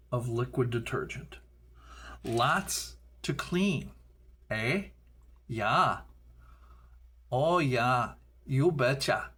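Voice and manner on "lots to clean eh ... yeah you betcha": put-on broad Minnesota accent, drawn-out vowels. Chatting to viewers about cleaning the Bean, Minnesota accent. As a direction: slip playfully into a folksy Minnesota accent. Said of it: "Minnesota accent coming in there".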